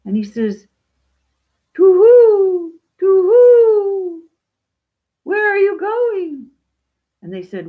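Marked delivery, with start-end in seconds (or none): 1.7-6.5 high, loud owl hoot, vowels stretched; high-pitched owl calling out. Acted. Owl character hooting its question.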